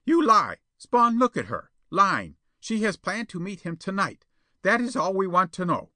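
high, loud, excited character voice; triumphant accuser. A narrator's reading. Perona exposes Jetta to Spawn, triumphant. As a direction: pounce on the lie, loudly.

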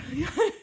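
high burst of laughter; loud laugh. Real, not acted.

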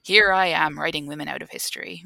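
loud gloating villain monk with a mock laugh; mustache twirling villain. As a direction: ham up an evil monk's boast. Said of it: "a mustache twirling medieval monk who says".

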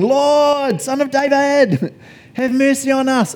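high, loud, drawn-out cry for mercy; shouting. Acted. Two blind men shouting to Jesus, high drawn-out shout. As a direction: call out long and high like someone begging from the roadside. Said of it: "they began shouting".

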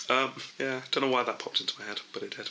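fading to a mumble; trailing off. Real, not acted. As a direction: let the words die away. Fading end of chat.

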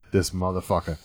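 low, soft curse to yourself; retold inner curse. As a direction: say it under your breath. Retold inner curse, then repeated slower.

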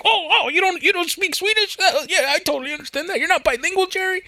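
high, fast, mocking taunt at Jerry; high mocking wrestler voice. Wrestler taunting commentator Jerry, in a high mocking voice. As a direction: sneer it up high like a heel wrestler. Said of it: "he's like".

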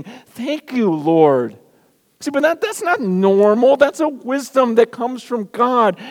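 high, loud, driving pulpit delivery; full-force preaching. Acted. Pastor preaching to his church, high and loud. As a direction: preach it hard to the congregation.

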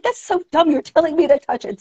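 high-pitched exasperated retort; mock indignation. Speaker arguing back at a don't-touch warning, exasperated and high.

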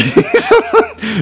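loud, high cackling laugh; high staccato laughter. Real, not acted. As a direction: burst out laughing at the punchline. Amused by his own story.

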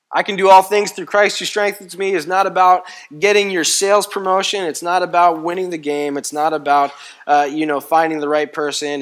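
loud, high, driving preacher's cadence; preaching at full force. Acted. Pastor rousing the congregation, full-force preaching. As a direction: hammer each not about home to the church. Preacher drives the point home.

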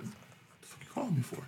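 muttered under his breath, no voice at all; hissed aside to himself as the phone rings; whisper. Retold or imitated. Man hearing his phone ring at work, whispering in disbelief. Reacting to her call at work.

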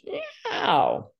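a high, silly little meow; comic cat noise for the panicking nerves; mock cat meow. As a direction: yowl like a spooked cat, playfully. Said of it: "the sympathetic nervous system goes".

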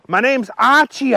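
high, exasperated reply. A narrator's reading. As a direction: insist on your name, fed up. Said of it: "Archie was exasperated".

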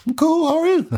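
very high, would-be casual reply; comically high-pitched small talk; awkward greeting. Retold or imitated. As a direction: act out nervous politeness, voice way up. Retells his awkward greeting to investigators.

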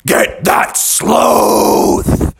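very slow, rasping beast yell; tiger yell. Acted. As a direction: drag out the order like a roaring tiger. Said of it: "the tiger yelled out".

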